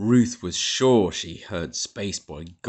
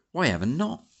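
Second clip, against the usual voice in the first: higher, firm challenge; demanding; 8 semitones higher, faster than usual. A narrator's reading. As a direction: demand an answer. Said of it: "demanded Ruth".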